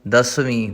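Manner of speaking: ਦਸਵੀਂ with the full wvi ending; correct pronunciation. Shown as a demonstration. Teacher correcting students to full ਦਸਵੀਂ, careful. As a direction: finish ਦਸਵੀਂ fully, nasal vowel at the end. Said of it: "ਦਸ ਨਹੀਂ ਕਹਿਣਾ".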